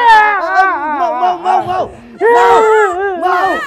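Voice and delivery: loud, high, hurried shouting; old man shouting orders; urgent shout. Acted. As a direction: hurry them with loud cries. Old man urgently hurrying someone.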